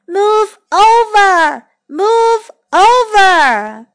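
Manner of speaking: sing-out "let me aboard" call. Acted. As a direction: call slowly across the water, stretching the vowels. Voice calling from the water.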